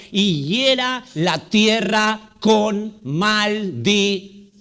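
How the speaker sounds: slow, weighty reading of maldición; slow solemn emphasis. Shown as a demonstration. Preacher reading a prophecy of curse, slow and solemn. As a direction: lean hard on hiera and maldición. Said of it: "escucha el término".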